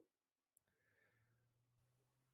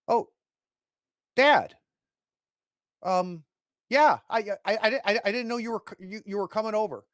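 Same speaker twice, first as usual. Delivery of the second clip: higher, stammering son caught off guard; flustered, tripping over every word; jittery son stuttering at his father; nervous stammer; 9 semitones higher, 9 dB louder than usual. Acted.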